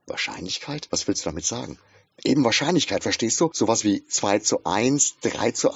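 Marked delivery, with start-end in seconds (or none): none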